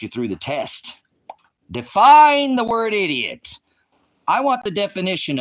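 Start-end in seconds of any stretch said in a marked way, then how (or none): 1.7-5.4 shouted demand. Real, not acted. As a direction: bellow it word by word. Repeats demand, then shouts it.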